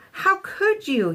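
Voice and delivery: high, slow, upset cry of How could you; an indignant wail at the wrecker; yelled. A narrator's reading. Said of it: "yelled Iguanodon".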